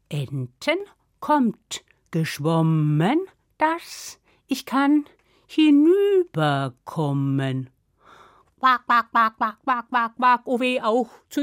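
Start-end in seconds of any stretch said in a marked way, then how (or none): 0.1-7.7 slow, low-starting spell with stretched vowels; magic incantation. Acted. Ogre's wife copies the duck spell, incanting. 8.6-10.4 brisk, even imitated quacks; duck quacking. Ducks come to the ogre's wife, quacking. Ducks answer the wife's spell.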